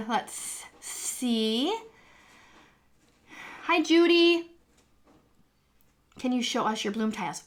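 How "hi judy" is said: excited greeting. Real, not acted. Greeting a viewer in chat.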